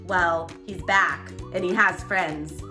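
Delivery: ordinary delivery, nothing marked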